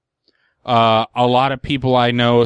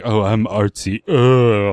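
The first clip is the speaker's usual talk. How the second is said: mock pretentious singer; 2 semitones lower, 3 dB louder, slower than usual. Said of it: "I think the guy's like".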